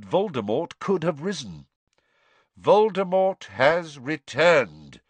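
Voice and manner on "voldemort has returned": slow, louder, weighty; grave Dumbledore. A narrator's reading. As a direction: repeat it slowly, each word heavy. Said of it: "Dumbledore repeated".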